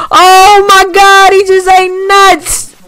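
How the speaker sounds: very high, loud drawn-out scream; excited scream. Real, not acted. Gamer reacting to a wild catch, excited scream. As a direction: shriek it in stunned excitement.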